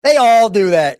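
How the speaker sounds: normal talking voice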